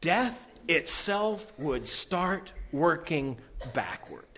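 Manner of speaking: slow, weighted restatement; solemn repetition. Shown as a demonstration. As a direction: let the line land for the congregation. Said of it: "In a nutshell".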